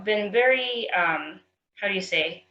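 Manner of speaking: ordinary delivery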